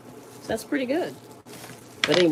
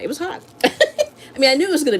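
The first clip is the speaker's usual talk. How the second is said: laughing; 12 semitones higher, 4 dB louder than usual. Real, not acted. Recalling eating very spicy food.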